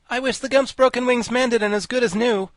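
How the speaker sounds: high, loud, grand declaration; high-pitched, projected solemn wish; impressive pronouncement. A narrator's reading. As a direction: proclaim the wish with importance. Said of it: "in a slow and impressive voice".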